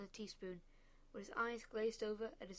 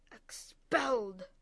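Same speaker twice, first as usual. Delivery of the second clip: a loud, slow, drawn-out bellow; outraged; 10 dB louder, much slower than usual. A narrator's reading. Father raging at breakfast, slow furious bellow. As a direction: spit the word out in fury.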